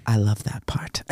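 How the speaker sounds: breathy mock whisper; hushed imitation of the wife. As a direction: whisper it like a secret. Retelling wife's line, whispered.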